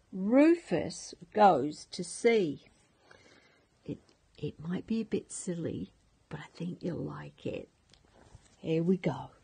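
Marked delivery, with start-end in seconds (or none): 3.8-7.7 a quick whispered promise; soft whisper. Real, not acted. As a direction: whisper it like a secret. Whispered aside to grandchildren.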